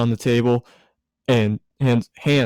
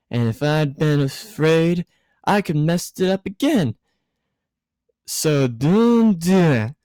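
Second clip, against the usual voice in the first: tipsy woman voice; 6 semitones higher than usual. Acted. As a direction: play her as a merry drunk woman. Misato voiced by reader.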